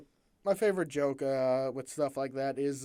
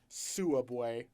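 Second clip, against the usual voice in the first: country twang; 5 semitones lower, 5 dB quieter than usual. Shown as a demonstration. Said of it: "I can tap into the country a little bit".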